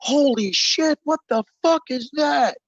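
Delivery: high startled shout; panicked high-pitched outburst; alarmed shout. Acted. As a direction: yell in shock at the creature. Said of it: "And you hear H and J".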